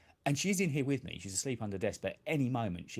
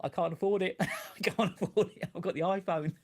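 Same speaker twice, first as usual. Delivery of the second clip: high laughing groan of despair; 9 semitones higher, 3 dB louder than usual. Real, not acted. Laughing to viewers about the unaffordable Pixel.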